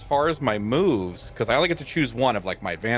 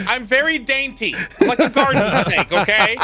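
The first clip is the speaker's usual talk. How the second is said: laughing; 12 semitones higher, 7 dB louder than usual. Real, not acted. Laughing self-mocking shark character.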